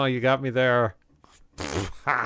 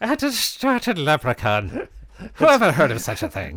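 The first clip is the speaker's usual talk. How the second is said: mock Irish accent; 5 semitones higher than usual.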